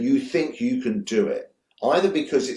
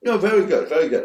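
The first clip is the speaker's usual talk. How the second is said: higher, louder, mock-patronizing praise; 7 semitones higher, 6 dB louder, faster than usual. Reassuring Tarantino about Kill Bill, hammed-up patronizing. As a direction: brush him off with hammed-up condescension. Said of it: "Like, really patronizing".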